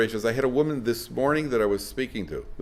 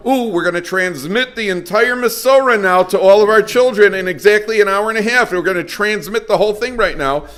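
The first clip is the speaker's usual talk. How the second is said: mock excited parent; 8 semitones higher, 6 dB louder than usual. Parodying overeager parents.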